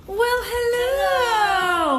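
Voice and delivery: high, slow, drawn-out hello; sing-song greeting. Real, not acted. Greeting at the office door.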